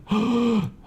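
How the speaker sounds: sharp intake of breath; startled gasp. Acted. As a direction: gasp as if suddenly afraid. Acts out fear after clicking.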